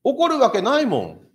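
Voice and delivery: high, loud, dismissive disciples insisting it can't happen; loud mocking "no way" from the disciples; scoffing disciples. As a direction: scoff like doubting disciples sure nothing will grow. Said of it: "弟子たちは".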